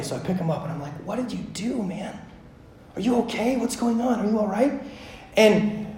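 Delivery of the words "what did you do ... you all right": put-on softly questioning a hurt child; hushed parental concern; concerned father. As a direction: check gently on your kid, voice dropped. Father checking on fallen child.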